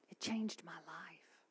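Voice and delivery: slow, moved whisper; very soft, heartfelt hush; hushed sincerity. Real, not acted. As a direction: let it out on a breath, slowly. Moved testimony.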